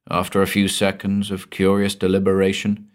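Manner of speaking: ordinary delivery, nothing marked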